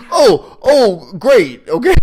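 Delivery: high, loud hammed-up agreement; overjoyed sarcastic yes-man; mock cheerful follower. Mocking the followers to the co-host, high and hammed up.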